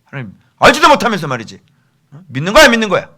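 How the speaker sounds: loud, high interrogating scold; stern rebuke. Shown as a demonstration. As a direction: press them hard for an answer. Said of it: "어미 꾸짖으라". (언성을 높여 엄하게 추궁하듯)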